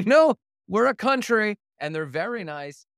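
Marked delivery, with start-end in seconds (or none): 0.1-1.6 high, loud, mock-indignant correction; indignant retort. Canadian border officer correcting an American visitor, mock indignant. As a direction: protest it loudly, pitched way up.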